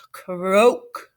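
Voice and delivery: deep froggy croak. Acted. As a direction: croak like a frog. Frog sound read aloud.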